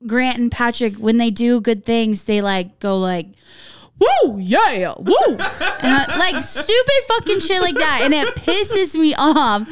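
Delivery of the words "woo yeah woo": showy mock victory whoop; swooping, over-the-top celebratory woos; imitated celebration whoops. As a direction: whoop like a gloating player. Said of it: "they make these noises".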